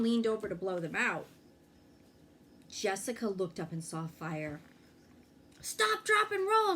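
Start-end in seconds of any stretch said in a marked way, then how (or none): 5.6-6.7 high, loud panicked scream. A narrator's reading. Jessica sees candle fire at party, screaming warning. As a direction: shriek it out in alarm, much higher and louder. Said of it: "she screamed".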